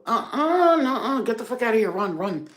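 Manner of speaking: high, loud recoil fading down; hammed-up revulsion at a dirty store; disgusted refusal. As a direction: back away from the counter in disgust. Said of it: "you're like".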